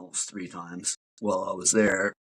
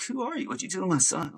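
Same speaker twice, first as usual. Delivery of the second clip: much higher, squeaky imitation of his mom; a son mimicking his astonished mother, pitched way up; mock disbelieving mom; 9 semitones higher than usual. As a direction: play the stunned mother in a high put-on voice. Mom voiced again, disbelieving.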